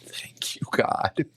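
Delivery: soft whispered thank you. Retold or imitated. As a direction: breathe it out as a relieved whisper. Standing aside, relieved aside.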